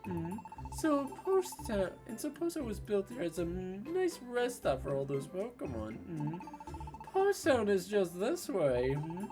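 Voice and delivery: lightly voiced upbeat companion; cheerful game character. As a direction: read the partner's lines brightly. Reads game partner's lines.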